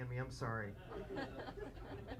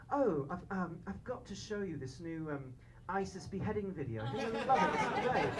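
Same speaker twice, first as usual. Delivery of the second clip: put-on soft, pleasant London English accent; 6 semitones lower, 9 dB quieter than usual. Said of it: "it's amazing with their accents".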